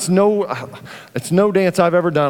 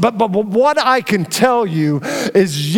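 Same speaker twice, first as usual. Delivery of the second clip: normal talking voice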